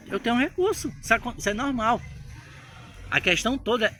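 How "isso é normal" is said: ordinary talk, nothing marked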